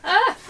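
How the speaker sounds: short, high, loud "ah!"; sudden little cry of surprise; startled yelp. Real, not acted. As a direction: yelp as something flies out at you. Startled as the card flies out.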